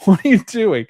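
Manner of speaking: laughing high and loud at his silliness; amused disbelief, breaking into a laugh; laughing at him. Retold or imitated. Alice laughing at Jimmy's robot walk, loud and amused.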